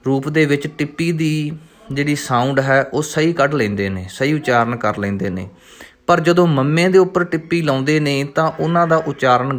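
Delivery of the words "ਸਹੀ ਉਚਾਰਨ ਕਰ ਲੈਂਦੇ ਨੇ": ordinary delivery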